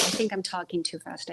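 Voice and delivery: ordinary talk, nothing marked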